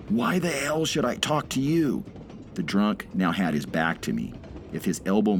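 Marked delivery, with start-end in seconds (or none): 0.1-2.0 put-on high, rough drunk's roar. A narrator's reading. Drunk laborer roaring at the old man. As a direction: shout it over the train noise. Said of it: "roared above the clacking wheels".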